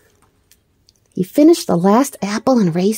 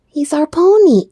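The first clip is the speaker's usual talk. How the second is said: proud, louder declaration; proudly; 7 semitones higher, 4 dB louder than usual. A narrator's reading. Edith proudly tells Mister Bear about the pony. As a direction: announce it with pride. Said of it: "declared proudly".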